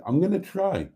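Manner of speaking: first take pitched well up, falling; a lifted, half-jokey promise; high first try. Real, not acted. To himself about the new season, high and bright.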